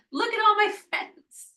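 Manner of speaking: Venus/character; delighted Venus showing off. Acted. Venus returns with her planet entourage, playfully thrilled. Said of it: "Like, she's got".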